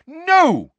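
very high, loud refusal; explosive motherly no; shout. A narrator's reading. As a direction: shout it down at the children. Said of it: "shouted Missus Weasley".

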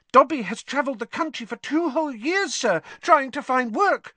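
high, squeaky elf voice; squeaky house elf. A narrator's reading. Dobby telling Harry of his search for work, squeaky. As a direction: squeak it out earnestly. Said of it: "Dobby squeaked".

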